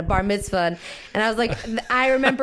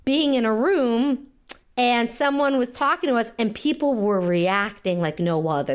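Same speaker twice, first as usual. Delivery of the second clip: ordinary delivery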